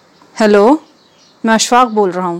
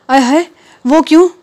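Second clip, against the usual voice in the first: shocked surprise; 8 semitones higher, 3 dB louder, faster than usual. Acted. Wife shocked husband goes to office.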